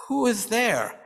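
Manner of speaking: a touch of fear, calling through the door; lightly frightened old woman. A narrator's reading. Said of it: "asks a frightened voice".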